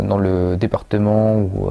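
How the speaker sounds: normal talking voice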